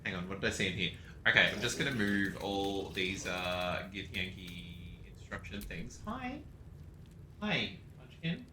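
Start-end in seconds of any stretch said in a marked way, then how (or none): 6.1-8.4 greeting the cat. Real, not acted. Streamer greets her cat in a high soft coo. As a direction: coo hello to a pet.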